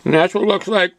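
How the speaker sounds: talking while chewing jerky; words around a mouthful of jerky; mouth full. Real, not acted. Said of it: "Sorry for talking my mouth full".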